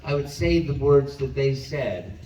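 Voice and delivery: ordinary talk, nothing marked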